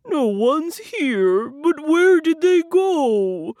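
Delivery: high, loud, slow baffled bear; loud, drawn-out puzzled wail; bear crying out. A narrator's reading. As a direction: cry out slowly as a storybook bear. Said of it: "Bear cries".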